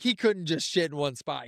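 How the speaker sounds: normal talking voice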